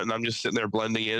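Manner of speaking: ordinary delivery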